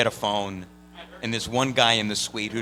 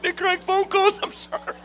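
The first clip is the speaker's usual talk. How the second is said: sheepish apology. Retold or imitated. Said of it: "I'm like".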